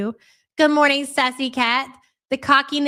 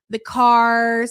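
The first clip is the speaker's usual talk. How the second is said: ordinary talk, nothing marked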